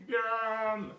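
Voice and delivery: hungry wolf chasing pigs, gleeful; greedy gloating wolf. Acted. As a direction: relish the thought of lunch.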